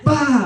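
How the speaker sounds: high, sharp nonsense yelp; a high manic bark of glee; sudden high yelp. Retold or imitated. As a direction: let out a burst of crazed release. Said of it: "I'm just losing it".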